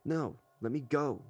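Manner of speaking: put-on high, petulant child voice; whiny little boy. A narrator's reading. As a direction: squeal it like a squirming kid. Boy squirms, refusing.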